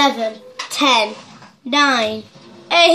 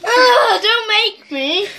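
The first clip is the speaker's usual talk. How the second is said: high, slow pleading whine; 7 semitones higher, slower than usual. Real, not acted. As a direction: beg not to. Dared to lick hot sauce.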